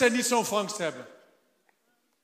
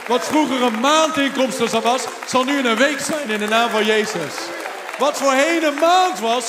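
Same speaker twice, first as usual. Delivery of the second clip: louder, declaring blessing; full-force preaching; 2 semitones higher, 10 dB louder than usual. Real, not acted. Pastor declaring blessing over restaurant owners, loud preaching. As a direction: proclaim it with conviction.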